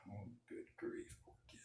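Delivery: normal talking voice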